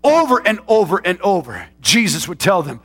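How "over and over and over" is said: loud, high, driving pulpit voice; full-force preaching. Acted. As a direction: preach it to the back row.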